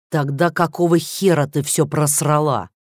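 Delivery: slow, loud furious growl. Acted. President growls at the chess player in slow fury. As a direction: spit it out slowly in rage. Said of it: "прорычал он".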